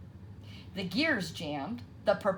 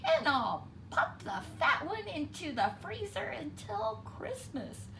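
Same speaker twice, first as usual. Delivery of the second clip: scheming fox; 10 semitones higher than usual. A narrator's reading. Fox plotting to himself.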